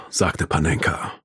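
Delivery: ordinary delivery, nothing marked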